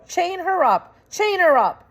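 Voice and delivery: very high, loud rat scream; shouting. Acted. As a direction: order it furiously. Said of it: "shouted Roscuro".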